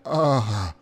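dazed gamer groan; zombie-like groan. Retold or imitated. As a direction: grunt it out like you're dazed. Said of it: "the sound I made".

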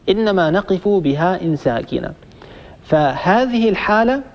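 ordinary talk

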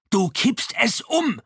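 high, slow, loud yell of alarm; shouting. A narrator's reading. Lavierge screams at Chaboneau rocking the canoe, high frantic shout. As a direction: scream the warning out across the water. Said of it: "schrie Lavierge".

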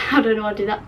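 usual speaking voice

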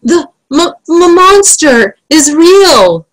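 high, stammering fright; King Fred gulping. Acted. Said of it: "gulped Fred".

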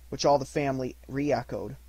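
plain reading voice

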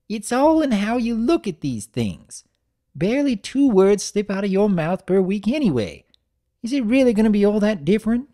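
put-on high, loud, fast old-mare chatter; hammed-up no-nonsense grandmother; brisk granny. A narrator's reading. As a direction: rattle it off like a spry granny. Granny teases.

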